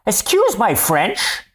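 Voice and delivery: high, slow put-on English "Excuse my French"; English accent. Host imitates an Englishman apologizing, high and slow. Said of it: "c'est un Anglais qui dit".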